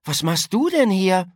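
high, surprised exclamation; taken aback, pitch jumping up; astonished question. A narrator's reading. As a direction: ask in open amazement. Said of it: "rief Yolanda erstaunt".

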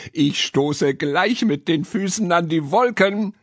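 high, happy cry; delighted little girl, pitched well up; gleeful child calling. A narrator's reading. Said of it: "rief das Mädchen vergnügt".

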